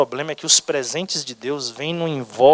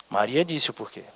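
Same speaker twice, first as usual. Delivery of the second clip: ordinary talk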